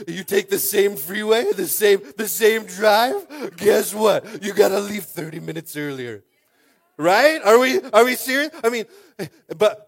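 loud, slow, high-pitched preacher drive; full-force preaching. Acted. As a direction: preach it hard to the room.

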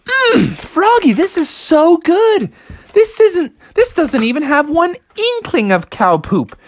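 very high, thrilled voices; delighted family. Acted. Family praising Froggy's pie, high and delighted. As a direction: gush between bites.

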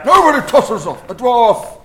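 high, loud, slow mock film quote; hammed-up dwarf. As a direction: bellow it like an indignant dwarf. Gimli quote, acted loudly.

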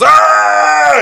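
very high, loud imitated roar; mock berserk scream. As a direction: let out an exaggerated freak-out scream. Imitates Alex's outburst.

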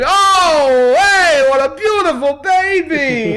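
slow, greasy wise-guy flattery; goomba voice. Retold or imitated. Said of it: "the same fucking Goomba comes".

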